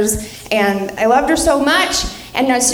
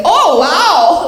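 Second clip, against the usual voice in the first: high, loud, delighted "oh, wow"; grandma's admiring catchphrase; 4 semitones higher, 5 dB louder than usual. Retold or imitated. Grandma admiring granddaughter ready for prom, gushing. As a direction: imitate grandma gushing, pitched up. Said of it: "she loves that phrase".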